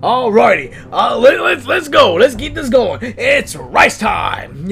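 loud rallying cry, last words drawn out; hammed-up hero battle call; superhero. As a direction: hype up the attack, ending on a slow catchphrase. Hero's battle catchphrase.

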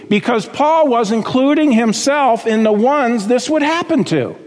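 ordinary delivery

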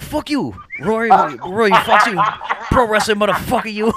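hammed-up drunken rant, laughing through it; mock shout. Acted quarrel, voicing Mike.

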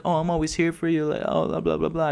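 high-pitched mocking imitation of sympathy; fake sympathy. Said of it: "A bunch of fucking fake nonsense".

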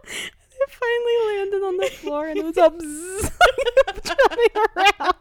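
high, loud words broken by real laughter; can barely get it out for laughing; laughing through it. Real, not acted. [name] ends her embarrassing story, laughing hard.

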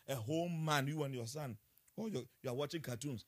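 ordinary delivery

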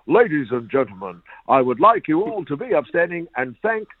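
put-on announcer's best English accent, rising to the band's name. Prankster at the ground announcer's microphone, mock English accent. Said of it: "in his best English accent".

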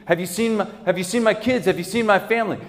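high-pitched, urgent questioning; frantic asking. Acted. Father asking around the street for his missing family, frantic.

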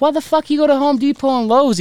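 high, incredulous mock yell. Dad questioning the thief's route, high incredulous yell. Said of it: "I was like".

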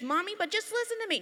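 put-on high, fast pleading kid; a little boy arguing with his mom about shoes; whiny child plea. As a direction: plead your case like a stubborn boy.

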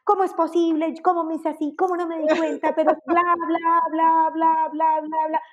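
a hint of an overthinker's racing mind; anxious mental chatter. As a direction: spin in an inner monologue of worry. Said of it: "empieza en este discurso mental".

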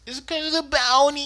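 high, fast whiny Vash voice; imitating Vash. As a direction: whine it quickly in a put-on pitch. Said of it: "He does say it exactly like that".

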